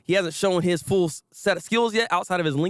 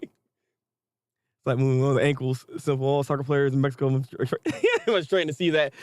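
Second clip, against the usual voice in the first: mock commentator voice; 5 semitones lower than usual. Mocking voice, laughing.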